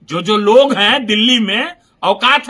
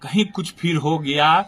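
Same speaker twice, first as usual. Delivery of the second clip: lowered, softer voice; voice turned down; 6 semitones lower, 6 dB quieter than usual. Shown as a demonstration. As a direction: bring the voice down low and soft. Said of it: "आवाज को down करके".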